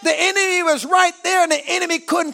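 slow stretched phrases climbing high; climactic preaching. Acted. Preacher declaring the enemy couldn't touch them, climactic cry. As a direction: cry out the victory to the congregation.

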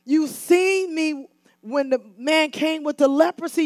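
normal talking voice

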